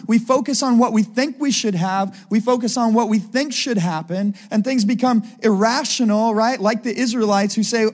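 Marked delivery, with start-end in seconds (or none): none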